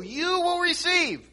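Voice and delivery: YOU pitched high in a slow phrase; stress on YOU. Shown as a demonstration. Preacher showing what the verb form emphasises, stress on YOU. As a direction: draw out "You will receive", high, leaning on YOU. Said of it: "put the emphasis on you".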